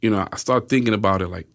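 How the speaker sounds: usual speaking voice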